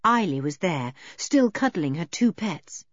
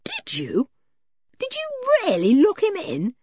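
delighted disbelief, leaping high; thrilled, incredulous question; 13 semitones higher than usual. A narrator's reading. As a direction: ask with rising, delighted surprise.

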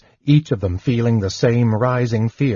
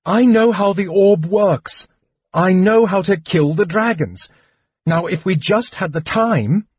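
excited; 9 semitones higher than usual. A narrator's reading. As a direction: rush it out in thrilled urgency. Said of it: "shaking him in her excitement".

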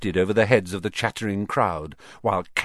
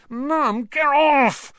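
high, loud squirming protest; annoyed protest; 5 dB louder, much slower than usual. A narrator's reading. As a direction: wriggle away, embarrassed and cross. Boy squirms from mother.

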